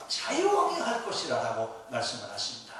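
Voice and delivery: ordinary delivery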